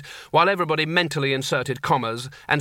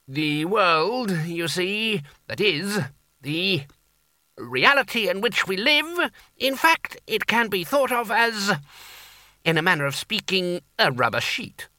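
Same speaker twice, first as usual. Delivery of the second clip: fussy, hesitant academic; a lecturer in law groping for words; rambling lecturer; 6 semitones higher, slower than usual. Acted. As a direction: fumble through the explanation.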